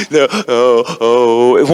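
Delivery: the breakdown in the booth; mock ugly cry. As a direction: imitate a wracked cry. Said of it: "One of those ugly cries".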